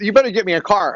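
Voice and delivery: a loud, forceful warning to the rental staff; angry demand. Retold or imitated. Angry customer warns the rental staff to get a car, loud and forceful. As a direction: bark the demand loudly, fed up. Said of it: "So I got really angry".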